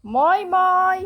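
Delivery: loud high call. Real, not acted. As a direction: call the animal from afar. Calling out to the animal.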